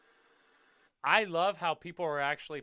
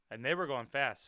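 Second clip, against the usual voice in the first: usual speaking voice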